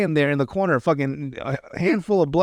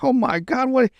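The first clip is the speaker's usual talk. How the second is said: high, imitated horror; shocked mock gasp; 9 semitones higher than usual. Voicing Trevor seeing Timmy down, shocked mock horror.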